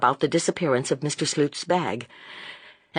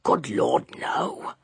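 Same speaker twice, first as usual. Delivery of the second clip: whispered reply; 6 semitones lower, much slower than usual. A narrator's reading. Inspector whispers back.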